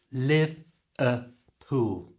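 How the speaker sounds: Live-a-pool spelled out slowly, schwa in the middle; slow Live-a-pool, each syllable apart; Live-a-pool drawn out slowly for learners. Shown as a demonstration. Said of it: "changed to a schwa sound".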